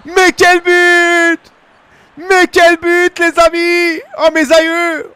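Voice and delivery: screamed high and loud, stretching each word out; goal celebration. Real, not acted. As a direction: roar it like a stadium commentator after a goal. Goal scored in football game.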